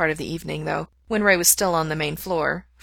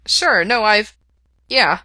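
louder, higher flustered stammer; 4 semitones higher, 7 dB louder, slower than usual. A narrator's reading. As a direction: fluster through it. Flustered reply.

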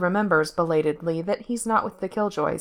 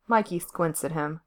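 ordinary delivery

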